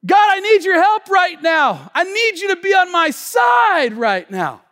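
loud, high, shouted cry to God; fervent shouted prayer; desperate prayer. Acted. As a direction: plead at full force. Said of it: "Here's been my prayer".

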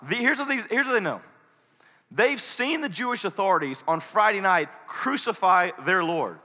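usual speaking voice

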